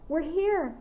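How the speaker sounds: high, happy announcement; joyful shout. A narrator's reading. Clara calling from the hilltop, joyful shout. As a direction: cry out the arrival with joy. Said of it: "Clara shouted joyfully".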